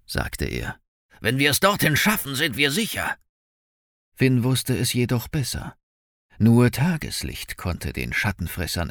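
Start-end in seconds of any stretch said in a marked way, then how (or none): 1.2-3.2 higher, hurried man in danger; urgent bounty hunter. A narrator's reading. Bounty hunter to Finn as shadows close in, urgent. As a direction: rush it with raised pitch.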